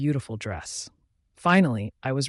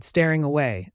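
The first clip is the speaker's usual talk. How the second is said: plain reading voice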